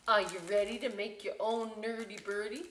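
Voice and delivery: usual speaking voice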